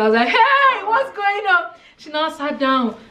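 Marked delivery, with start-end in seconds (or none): 0.3-1.6 high startled call out to a panicking stranger; alarmed call. Retold or imitated. To the crying patient, a high alarmed hey. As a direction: pitch jumps up in alarm.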